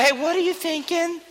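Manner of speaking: mock girlfriend asking. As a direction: sweetly imitate a girl prying.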